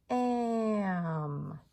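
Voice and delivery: "Am." stretched in one long glide; smooth blending. Shown as a demonstration. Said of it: "smooth blending".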